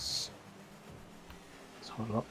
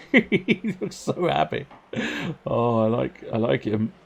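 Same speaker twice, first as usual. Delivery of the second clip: amused; 9 semitones higher, 5 dB louder, slower than usual. Real, not acted. Amused at the bouncing car.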